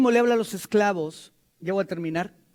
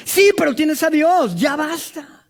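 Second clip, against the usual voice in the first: louder, firm retort; forceful rebuke; 6 semitones higher, 7 dB louder, slower than usual. Real, not acted. Preacher answers the excusing parent, louder and firm. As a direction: shut the excuse down.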